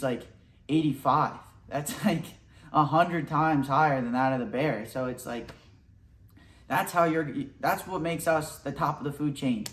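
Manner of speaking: ordinary talk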